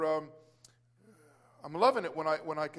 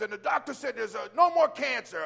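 excited news; 5 semitones higher, 7 dB louder than usual. Retold or imitated. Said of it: "He says".

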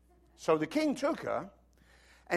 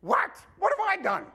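shocked king; 6 dB louder than usual. Retold or imitated. King protesting to God.

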